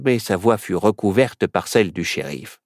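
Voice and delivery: usual speaking voice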